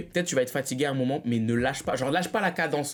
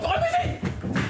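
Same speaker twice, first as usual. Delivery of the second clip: mock yell; slower than usual. Mock chase.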